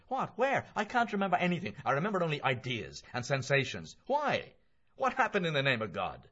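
high, loud, incredulous questioning; agitated Mulligan. Acted. Voices startled Mulligan in dialogue.

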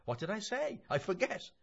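high-pitched, offhand question; lifted, animated character voice; breezy Mulligan. Acted. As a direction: toss it off high and careless. Mulligan's part in dialogue.

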